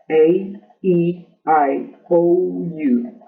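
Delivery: A, E, I, O, U said as English letter names, one by one; long vowel names. Shown as a demonstration. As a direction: spell the vowels out like a teacher. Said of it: "Aquí pueden ver los cinco vocales".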